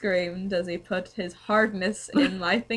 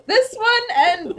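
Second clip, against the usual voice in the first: laughing; 12 semitones higher, 4 dB louder, slower than usual. Real, not acted. Laughing while reading aloud.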